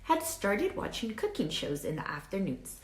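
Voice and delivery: put-on lively read-aloud for children; story narration. A narrator's reading. Teacher reading a storybook to her class, lively read-aloud. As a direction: tell it like a picture book to kids. Reads picture book to class.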